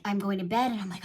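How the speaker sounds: ordinary delivery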